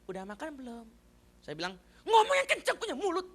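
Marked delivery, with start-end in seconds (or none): 0.1-0.9 very soft, gentle question; soft-spoken wife. Retold or imitated. Soft-spoken wife asking her husband about food, quiet. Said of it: "Kalau dia ngomong itu pelan". 2.1-3.3 high, loud mock scolding; snapping husband. Mock husband yelling at wife.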